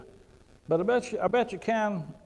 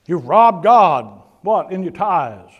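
much louder and very slow, sarcastic; mocking proclamation; 17 dB louder, much slower than usual. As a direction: boom it out slowly, mocking the old demand. Mock quoting Malachi, ridiculing it.

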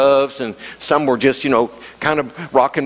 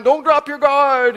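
much higher, slow, yelled warning; coach yell; 11 semitones higher, slower than usual. Retold or imitated. Boxing coach yelling at the young fighter from the sideline. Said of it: "he yelled from the sideline".

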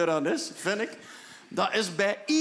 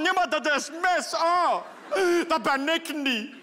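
dismayed; 6 semitones higher, slower than usual. Retold or imitated. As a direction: fret over your own voice. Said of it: "hoor het zelf van".

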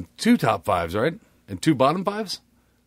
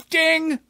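high bell ding. Acted. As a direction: chime like a bell. Mock bell sound.

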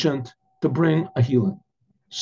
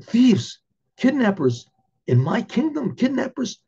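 outraged king; 6 semitones higher, slower than usual. Acted. King echoes in outraged disbelief.

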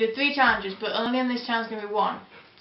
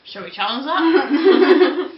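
laughing; 2 semitones higher, 9 dB louder, much slower than usual. Real, not acted. Laughing playful challenge.

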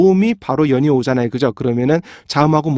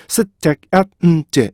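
셋, 택, 앳, 음, 제 as five separate beats; staccato syllable-by-syllable demo; syllables split apart; 2 semitones higher, slower than usual. Shown as a demonstration. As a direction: break it into five evenly timed syllables. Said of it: "이렇게 분리가 돼야지만".

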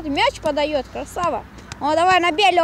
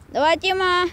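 usual speaking voice

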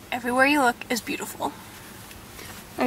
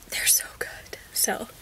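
whispering; 12 dB quieter than usual. Real, not acted. Secretive about smoothies.

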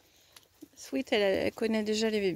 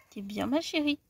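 usual speaking voice

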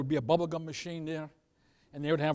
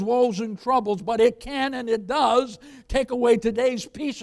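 loud, emphatic preaching with drawn-out key words; 6 semitones higher, 10 dB louder than usual. Acted. Pastor assuring the congregation God will feed them, preaching.

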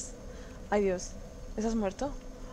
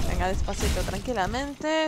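despacio y tranquila; 4 semitones lower than usual. Real, not acted. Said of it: "Esta es mi táctica". (despacito y con calma)

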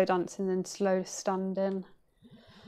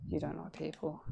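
much softer repeat; quiet; 3 semitones lower, 12 dB quieter than usual. Real, not acted. Talking to herself in mirror, softly. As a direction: say it again under your breath.

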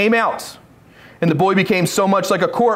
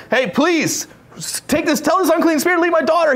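higher, hurried begging; desperate plea; 8 semitones higher than usual. Retold or imitated. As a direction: plead in a raised, quickening voice. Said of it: "she was desperate".

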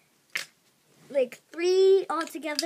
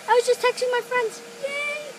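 hyper excited; 9 semitones higher than usual. Real, not acted.

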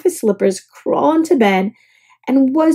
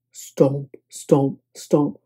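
low, soft stomping; heavy stomps; 8 semitones lower, 5 dB quieter, much slower than usual. A narrator's reading. Narrator sounding dinosaur footsteps, low.

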